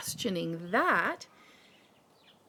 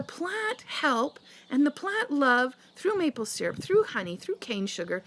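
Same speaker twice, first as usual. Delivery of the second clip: indignant rant; 5 semitones higher than usual. Real, not acted. Ranting at sugar critics.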